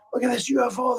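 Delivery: high-pitched mock yell at normal volume; retold excited yelling. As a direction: imitate friends yelling about a sighting, without real volume. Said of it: "They're yelling and screaming".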